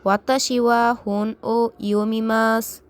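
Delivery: teacher modelling Japanese, final su dropped; model repetition. Shown as a demonstration. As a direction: say it clearly for learners to repeat. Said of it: "আমার সাথে বারবার উচ্চারণ করুন".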